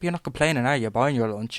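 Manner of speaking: ordinary talk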